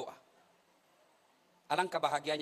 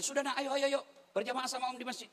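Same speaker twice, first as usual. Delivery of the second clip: calling nephew; 7 semitones higher than usual. Retold or imitated. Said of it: "Saya panggil".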